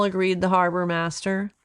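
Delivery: ordinary delivery, nothing marked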